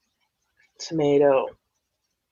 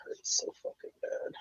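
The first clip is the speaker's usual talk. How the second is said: pained whisper; 10 dB quieter than usual. Real, not acted. Back pain, unvoiced.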